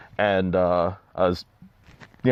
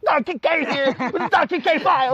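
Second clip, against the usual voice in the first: high, hammed-up host catchphrase; Doctor K impression. Imitating his friend greeting wrestlers, high hammed-up catchphrase. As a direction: pitch it up like a showman signing on. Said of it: "That's pretty good impersonation".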